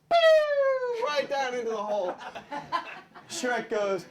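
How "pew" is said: very high, loud held "pew"; whistling plunge sound. Acted. Creature goes down into the hole, high loud "pew". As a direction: shoot out a piercing cartoon noise. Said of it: "as this silly creature, go".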